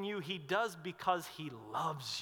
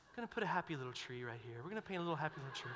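hushed, fast, lower imitation of a gentle TV painter; soft soothing Bob Ross; 4 semitones lower, 8 dB quieter, much faster than usual. Preacher imitating Bob Ross's gentle TV voice, hushed and quick. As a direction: lull them like the painting show host. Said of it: "he would talk in that soft, soothing voice".

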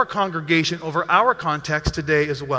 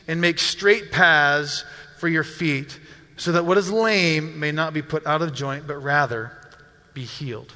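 scripture reading; 3 semitones lower than usual. Acted. Pastor closes the passage, deliberate scripture reading. Reads scripture aloud to congregation.

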